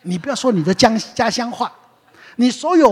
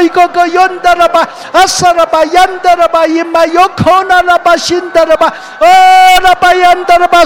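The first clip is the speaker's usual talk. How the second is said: loud, high, fervent glossolalia poured out without pause; speaking in tongues; 10 semitones higher, 11 dB louder than usual. Real, not acted. Preacher leading the congregation in tongues, loud and high fervent. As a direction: let the syllables gush out loud and high, full faith. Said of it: "好，我们来说".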